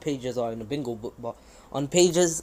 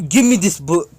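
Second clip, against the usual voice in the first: loud acted outburst; urgent demand; 3 semitones higher, 10 dB louder than usual. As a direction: demand it at nearly a shout. Acting Naruto grabbing the book.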